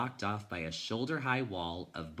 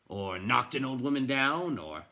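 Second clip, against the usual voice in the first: gruff accusation; 5 semitones higher, 5 dB louder than usual. A narrator's reading. Bookseller speculates accusingly.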